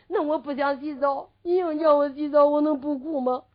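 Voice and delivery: mock crying, pitch held up; tearful protest. A narrator's reading. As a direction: whine it out like a put-on sob. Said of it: "他就哭起来了".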